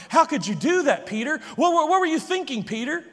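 an imagined harsh God jeering at Peter, mocking and loud; mocking scold. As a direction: scold Peter with a cruel sneer. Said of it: "Mocking him".

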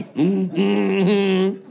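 loud drawn-out mm-hmm; mock disapproving hum; resentful mm-hmm. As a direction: hum a long, knowing mm-hmm. Acted resentful hum after complaint.

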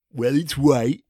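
put-on botched British accent that lands in Boston; attempted British accent. Co-host quoting Stephen Merchant's swan description, botched British accent. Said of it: "Boston accent".

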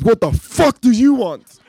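high, loud, annoyed God; irritated God. Acted. God to the atheist, loud and irritated. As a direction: bark at the atheist like a bothered deity. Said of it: "And God says".

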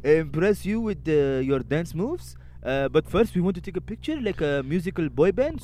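put-on soft accented voice sliding into Russian; quiet, put-on Russian-style English; botched French director. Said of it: "Because he became Russian".

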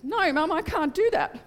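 high, loud defiant no; loud, high-pitched angry refusal. Retold or imitated. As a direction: throw the refusal back at Mum. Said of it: "I got really angry".

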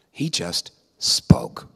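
very slow, whispered, almost breathless; hushed and drawn out; reverent whisper. Real, not acted. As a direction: let the words fall away in awe. Hushed emphasis on Jesus' calm power.

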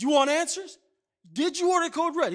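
high, loud movie-scene yelling; reenacting the Few Good Men court showdown, yelling; heated courtroom shouting. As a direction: shout it like the climax of a trial. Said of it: "He says".

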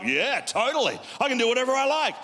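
high-pitched, breezy overconfidence; mock carefree reader. Preacher imitating someone misreading the Bible, mock carefree. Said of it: "you can't just read the Bible and be like".

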